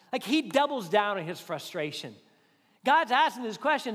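ordinary delivery

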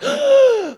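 sharp high gasp; gasping for breath. Retold or imitated. Kid surfacing in the shallow end, gasp. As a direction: suck in air hard after surfacing. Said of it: "gasping for breath".

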